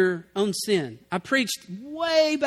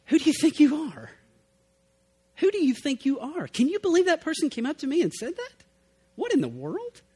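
indignant; 6 semitones higher than usual. Retold or imitated. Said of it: "See, you're mad".

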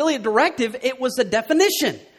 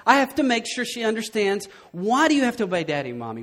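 ordinary talk, nothing marked

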